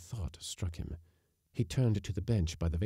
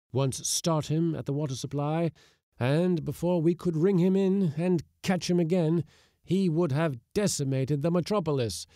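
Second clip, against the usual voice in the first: mounting rhetoric; 6 semitones higher, 4 dB louder than usual. Acted. Anarchist rhetoric carried away.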